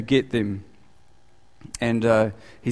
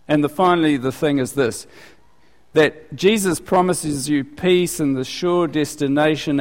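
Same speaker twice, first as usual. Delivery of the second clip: ordinary delivery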